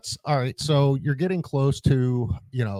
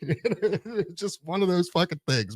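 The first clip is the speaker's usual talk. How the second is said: laughing; 8 semitones higher, slower than usual. Real, not acted.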